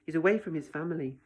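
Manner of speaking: plain reading voice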